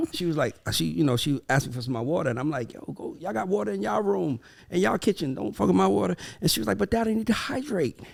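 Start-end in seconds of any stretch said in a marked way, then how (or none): 2.7-6.2 mock complaint. Retelling shooing his daughter off. 6.8-8.0 put-on high, small child's voice; little daughter. Daughter answering her dad about water, high imitated child voice. Voicing his young daughter.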